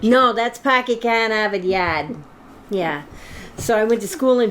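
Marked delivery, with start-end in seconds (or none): 0.0-2.2 put-on Boston accent. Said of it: "Is that your Boston accent?".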